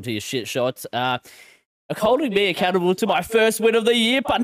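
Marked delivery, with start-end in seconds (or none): 2.0-4.5 excited. Real, not acted. Announcing his win to punters.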